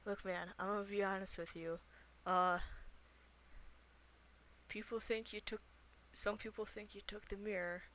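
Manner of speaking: soft, hushed and confiding; half-whispered. Acted. Player persuading a hidden character about the mirror, half-whispering.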